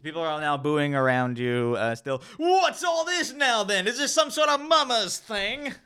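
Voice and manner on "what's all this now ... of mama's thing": a shrill busybody in the crowd; scolding townswoman. Acted. Crowd woman scolding, acted.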